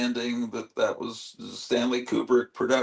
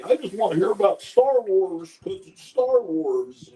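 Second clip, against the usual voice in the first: slightly higher dopey fan voice; mock dumb voice; 5 semitones higher than usual. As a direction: play a simple-minded fan. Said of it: "to say".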